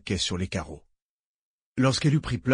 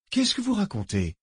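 irritated question, pitched up at the start; 9 semitones higher than usual. A narrator's reading. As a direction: demand it with some annoyance. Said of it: "demanda Barent irrité". (irrité)

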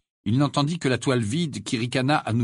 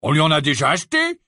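bitter; 5 semitones higher, 6 dB louder, faster than usual. A narrator's reading. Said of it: "dit Fred avec amertume".

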